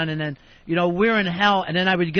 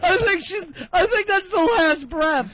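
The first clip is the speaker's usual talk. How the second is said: inconsolable; 12 semitones higher than usual. Retold or imitated. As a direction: wail it out like someone grieving. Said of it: "And Fez is like".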